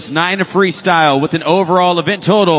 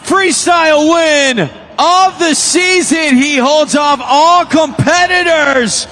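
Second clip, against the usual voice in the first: excited arena announcer call; 8 semitones higher, slower than usual. Real, not acted. Announcer calling the winner to the Saint Louis crowd, hyped. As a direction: build it up and boom the result to the crowd.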